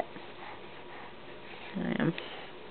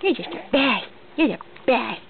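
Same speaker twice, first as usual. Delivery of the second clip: normal talking voice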